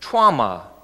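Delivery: Traumata repeated alone, TRAU-ma-ta stressed at the start; Greek word. Shown as a demonstration. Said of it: "interesting Greek words".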